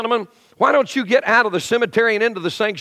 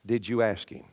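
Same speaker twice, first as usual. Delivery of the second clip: much softer, lower quiet ask; gentle quiet question; 10 semitones lower, 10 dB quieter than usual. Retold or imitated. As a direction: ask softly, just planting the thought. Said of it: "All she said was".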